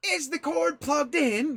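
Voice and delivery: exasperated, high and loud tech support; hammed-up frustrated help-desk worker; imitating fed-up IT guy, loud and high; Roy. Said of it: "how frustrated he gets".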